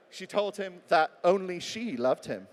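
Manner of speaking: slow line, "only she" set apart; deliberate, spotlighting "only she"; stress on ONLY SHE. Shown as a demonstration. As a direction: lean slowly and heavily on "only she". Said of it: "Put the word only in between".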